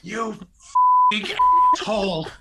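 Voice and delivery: furious high scream, re-enacted. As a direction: scream the insult at the top of your voice. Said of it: "finally you're screaming".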